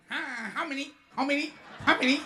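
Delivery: mock a rushed Chinatown waiter; brusque waiter. Chinatown waiter barking at customers, brusque.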